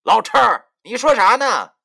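loud, high-pitched rebuke; yelled, indignant challenge; angry scolding. A narrator's reading. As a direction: cut your partner off with a sharp shout. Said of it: "文叔竟然对着林叔骂道".

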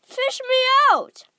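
high, loud desperate shout; boy yelling from the river. A narrator's reading. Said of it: "he yelled".

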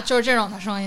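ordinary talk, nothing marked

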